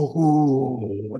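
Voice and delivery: long, low, softened crowd "oooh"; imitated crowd "ooh". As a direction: mimic a whole crowd reacting with a drawn-out ooh. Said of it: "you could hear that crowd go".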